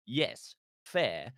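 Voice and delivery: normal talking voice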